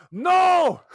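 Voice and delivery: put-on high, loud cry in a mystery accent; mock Scotty shout, odd accent; vague put-on accent. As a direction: yell it as a hammed-up Scotty. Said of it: "I don't know what accent I'm doing now".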